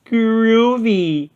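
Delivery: high, delighted Shaggy; Shaggy's voice. A narrator's reading. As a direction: savor it, hungry. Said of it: "Shaggy rubbed his belly".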